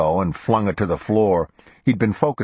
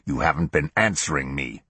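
snapping; 3 semitones lower, 3 dB quieter than usual. A narrator's reading. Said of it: "Thomas snapped".

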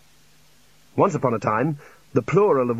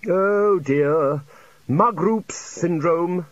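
higher, drawn-out character voice; pronouncing senior wizard; 5 semitones higher, much slower than usual. Acted. Senior wizard diagnosing magic sickness, drawn-out dismay. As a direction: draw out the diagnosis with mild dismay.

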